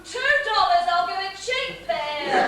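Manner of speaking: haggling. Acted. Wife haggling with husband for money, loud. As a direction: bargain loudly like a market seller.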